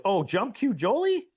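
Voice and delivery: high, rising disbelief on Jolie's name; shocked he grabbed the jump cue; surprised. Real, not acted. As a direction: pitch leaps up in amazement.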